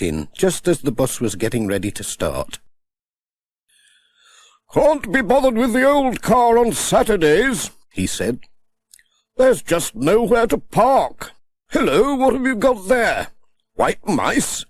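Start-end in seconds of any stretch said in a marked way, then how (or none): 4.7-14.6 higher, hearty chatty vicar; lightly voiced vicar on the bus; jolly vicar. A narrator's reading. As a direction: greet the children cheerfully. Voice for Reverend Timms.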